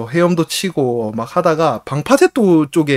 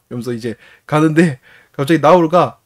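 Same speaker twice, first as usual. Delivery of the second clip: ordinary delivery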